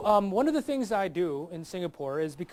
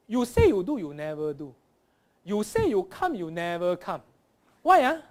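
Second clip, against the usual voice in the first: punchy, swooping Singlish complaint; loud zigzag Singaporean accent; 6 dB louder than usual. Shown as a demonstration. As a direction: scold in Singlish, jumping up on "Why, ah?". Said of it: "and Singlish".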